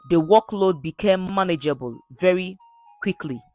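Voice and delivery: plain reading voice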